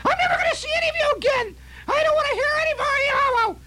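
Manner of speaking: very high, fast screeching exit line; furious aunt. Retold or imitated. Aunt storming out on the family, high screeching rage. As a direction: screech it while storming out the door.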